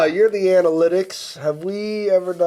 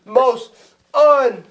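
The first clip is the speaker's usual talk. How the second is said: high, drawn-out showman hype; slow-building, high-pitched hype; hype announcer; 7 semitones higher, 4 dB louder, slower than usual. Pretend. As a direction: announce a champion with mock grandeur. Hyped introduction of the expert.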